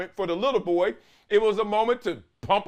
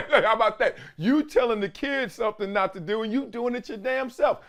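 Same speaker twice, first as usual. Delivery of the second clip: ordinary talk, nothing marked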